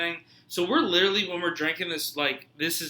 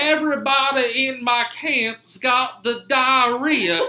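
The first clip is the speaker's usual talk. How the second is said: slow, high, loud Southern drawl; theatrical Civil War-era Southern accent; 8 semitones higher, 5 dB louder, slower than usual. Acted. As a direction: stretch it out in a mock Southern accent. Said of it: "like, a Southern person, my voice would".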